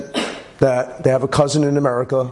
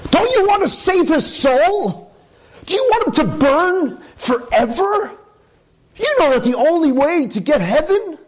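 high-pitched wife pleading. Retold or imitated. Wife urging her husband, high pleading voice. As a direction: beg him to save the boy's soul.

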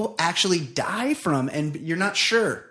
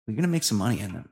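ordinary talk

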